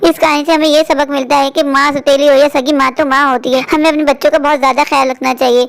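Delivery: calm closing moral for viewers; moral narration. A narrator's reading. Viewers hear the moral after the skit, told calmly. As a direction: speak the lesson gently, like a storyteller.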